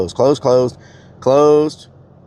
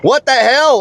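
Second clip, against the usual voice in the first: exasperated; 13 semitones higher, 5 dB louder than usual. Real, not acted. Frustrated by automated phone line.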